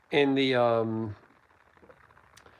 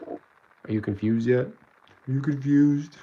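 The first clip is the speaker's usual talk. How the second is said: warped voice filter; much slower than usual. Shown as a demonstration. Playing with a warping voice filter.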